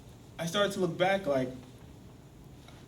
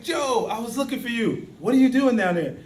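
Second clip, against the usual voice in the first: high, loud, astonished call; surprised calling out; 8 semitones higher, 8 dB louder than usual. Acted. Cousin finds Joe down the hole, surprised calling out. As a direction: call down to someone you never expected to find there.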